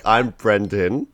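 slow, drawn-out self-introduction; slowed-down name. Shown as a demonstration. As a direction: stretch out every syllable of the name. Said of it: "slow down my name".

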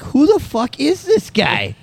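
stunned, can't place him at all; baffled aside. Retold or imitated. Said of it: "I was like".

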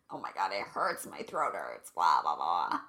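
very low, croaky strained voice; mock choked whine. Imitating someone holding back tears, croaky and strained. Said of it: "When you try to hold in those tears".

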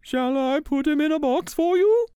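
bright helpful clerk; saleslady. Acted. Saleslady offering Lisa a box, high polite clerk voice.

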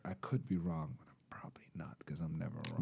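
very soft, low near-whisper; muttered aside. Real, not acted. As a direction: tuck it under your breath as a sly aside. Joking aside under the breath.

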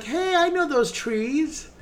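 high, drawn-out delighted call; mock excited recognition. Theatergoer spotting aspens from home, mock excited. Acts out reaction to seeing aspens.

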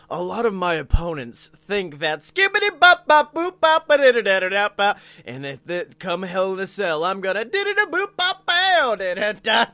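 loud mock promo bursting into scat; scatting wrestler promo. Co-host plays a wrestler cutting a promo, joyful loud scat parody. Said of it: "comes out for a promo and he's just like".

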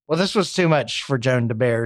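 plain reading voice

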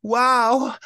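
high, loud mock amazement; a cop's exaggerated wow. Cop's reaction quoted.

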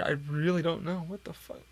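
ordinary talk, nothing marked

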